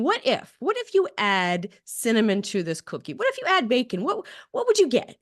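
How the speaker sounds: high, curious brainstorming questions; curious what-if. Acted. Woman explaining her love of experimenting, in curious what-ifs. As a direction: pitch it up, wondering aloud.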